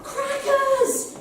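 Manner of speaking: high, ringing "Crackers!"; shouted cue word. Shown as a demonstration. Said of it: "I want you to all shout".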